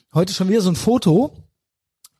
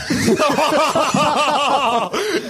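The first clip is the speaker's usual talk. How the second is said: high burst of laughter; 8 semitones higher than usual. Real, not acted. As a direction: laugh out at your own joke. Laughing at own joke.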